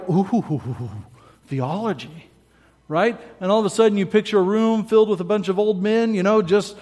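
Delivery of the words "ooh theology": low, hushed mock-reverent "ooh"; soft, low take-off of theology buffs; mock awe. Preacher imitating people keen on theology, mock awe. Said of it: "where people are like".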